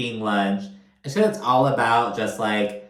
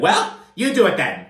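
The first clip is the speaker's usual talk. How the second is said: imitated angry outburst, pitched up and loud; pissed-off Todd; 8 semitones higher, 3 dB louder, faster than usual. Husband fed up with wife's apartment hunting, pissed off. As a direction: snap it out, mocking his temper. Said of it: "That's how fucking Todd talks when he's pissed off".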